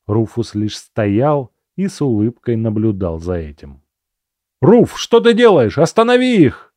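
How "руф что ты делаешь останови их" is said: high, loud desperate yell. A narrator's reading. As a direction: scream at him to stop it. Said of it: "заорал я".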